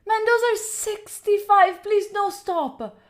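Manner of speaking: high, loud disbelief; overwhelmed protest. Real, not acted. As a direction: cry out, pleading for it to stop. Overwhelmed, begs donor to stop.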